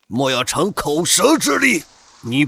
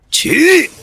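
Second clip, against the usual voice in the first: high, loud "起"; explosive casting cry; commanding shout; 9 semitones higher, 5 dB louder than usual. Acted. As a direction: bark the spell to life. Casting a technique.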